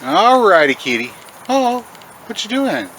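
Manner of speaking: coaxing the cat to come eat. Real, not acted. Greeting the cat.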